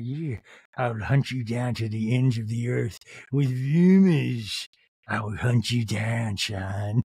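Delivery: low, put-on menacing threat; menacing assassin. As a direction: growl it like a villain hunting prey. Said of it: "She's like".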